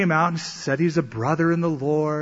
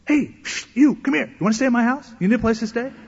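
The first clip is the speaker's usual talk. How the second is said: eager roommate calling; 8 semitones higher than usual. Retold or imitated.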